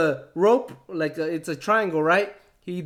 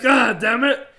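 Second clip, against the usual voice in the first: a loud frustrated curse; 4 semitones higher, 5 dB louder than usual. Retold or imitated. Champion cursing on hearing his next opponent. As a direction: spit it out in defeat. Cuerno hears bad news.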